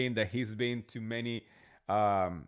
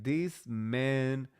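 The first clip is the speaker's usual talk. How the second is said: slow disbelief; much slower than usual. Retold or imitated. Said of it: "I was like".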